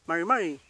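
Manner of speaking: MARI MARI run together almost as mar-mari; fast-speech form. Shown as a demonstration. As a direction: swallow the first i in MARI MARI. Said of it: "cuando se habla en forma rápida".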